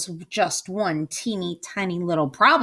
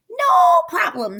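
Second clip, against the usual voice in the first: very high, loud witch shout; 6 dB louder than usual. Acted. Witch finding her ingredients, very high shout. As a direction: crow it triumphantly like a hag. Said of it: "said Gritch".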